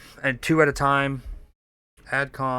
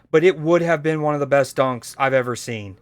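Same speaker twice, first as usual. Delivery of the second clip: ordinary delivery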